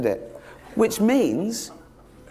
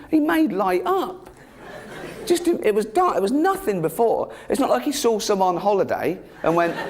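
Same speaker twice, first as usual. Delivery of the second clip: high, gushing mock wonder; mock amazement; 5 semitones higher than usual. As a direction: hype up the miracle like a superfan. Marvels at God making light.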